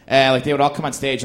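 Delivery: usual speaking voice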